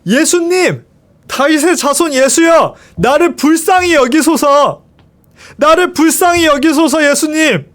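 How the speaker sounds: high, pleading call for mercy; desperate cry. A narrator's reading. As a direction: cry out to be heard over the crowd. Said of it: "벌떡 일어나서 외쳤어요".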